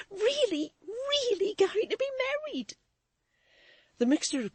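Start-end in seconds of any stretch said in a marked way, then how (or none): 0.1-2.8 high, tearful disbelief; tearful wonder. A narrator's reading. Maid marvelling at Florence's marriage, tearful and high. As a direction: marvel through tears. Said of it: "burst of tears".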